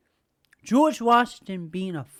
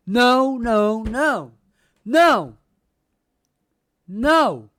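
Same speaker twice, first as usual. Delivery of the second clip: louder, emphatic mock refusal; 3 semitones higher, 8 dB louder, much slower than usual. Son refusing the father's apology, emphatic loud no. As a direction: hammer each no down hard.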